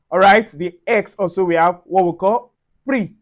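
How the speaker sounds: usual speaking voice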